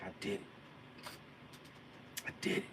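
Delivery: breathy near-silent I did it; hushed triumph. Retold or imitated. Just landed the box jump, hushed disbelief. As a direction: say it barely above a breath, amazed.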